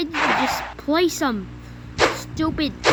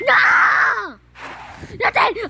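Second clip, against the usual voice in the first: desperate no; 9 semitones higher, much slower than usual. Real, not acted. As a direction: yell no as you crash. Gamer about to lose.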